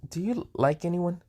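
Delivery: soft, high, shy-sounding question; quiet, hesitant. Acted. Maiden shyly asks Issei a question, quiet and high. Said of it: "she said quietly and kinda stuttery".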